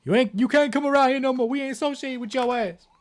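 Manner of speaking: high mock Norway telling Denmark off; Norway talking. Norway cutting Denmark off, high mock scolding. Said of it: "Norway was like".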